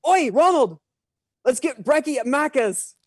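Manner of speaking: high-pitched, hammed-up Australian accent. American guest calling Ronald for breakfast, hammed-up Australian accent. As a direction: call to a mate in broad Australian. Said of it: "your best impersonation".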